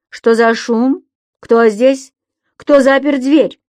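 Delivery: loud angry calls through a door; shouting. A narrator's reading. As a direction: shout it out, demanding. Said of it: "прокричал мужской голос".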